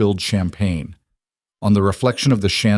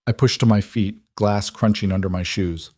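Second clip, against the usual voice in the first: ordinary delivery, nothing marked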